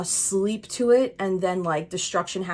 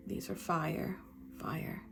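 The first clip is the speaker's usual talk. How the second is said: hushed emphasis; 12 dB quieter, slower than usual. Real, not acted. Soft repeated emphasis.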